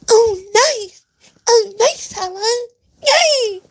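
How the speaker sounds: very high, whiny mock squeal; mimicking the girlfriend's squealing delight; mocking her voice. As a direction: squeak it out like a fake happy girlfriend. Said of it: "shit, her voice".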